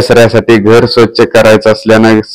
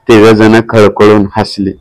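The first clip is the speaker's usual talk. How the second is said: ordinary delivery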